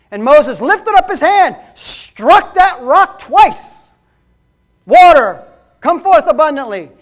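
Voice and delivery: loud, high fiery preaching; preaching at full force. Acted. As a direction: preach it hard to the congregation. Preaching loud about Moses.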